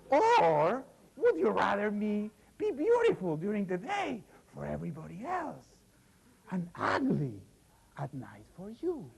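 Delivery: high, strained hag voice; the hag explaining her spell to her husband, high and strained; old hag voice. Acted.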